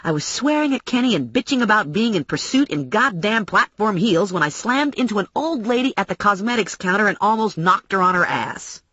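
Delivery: plain reading voice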